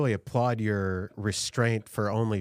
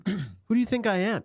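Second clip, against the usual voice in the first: mock diva; 10 semitones higher than usual. Mocking woman's demands.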